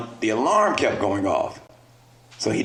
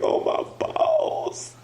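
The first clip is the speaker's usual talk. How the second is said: high, slow, breathy whimpering; a slow, high mock sob; 3 dB quieter, much slower than usual. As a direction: whine it like you might cry. Whimpering confession.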